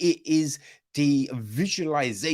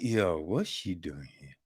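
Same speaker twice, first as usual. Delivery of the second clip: low, quieter mock mutter of a snide guest; suspicious gossip; 9 semitones lower, 8 dB quieter than usual. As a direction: drop low and sneer it sideways. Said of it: "some people would be like".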